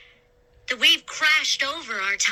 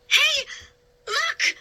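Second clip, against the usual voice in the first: calling out. A narrator's reading. Girl hailing a sailing ship, high urgent shout.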